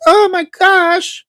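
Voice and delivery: put-on falsetto swoon at the accent; swooning fan. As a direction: gasp like a smitten fan. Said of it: "And then like".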